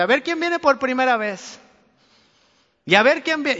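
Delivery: hammed-up pastor calling out newcomers; mock shouting greeter. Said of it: "lo está gritando así".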